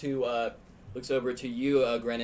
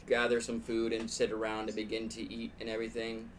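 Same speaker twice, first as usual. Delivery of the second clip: usual speaking voice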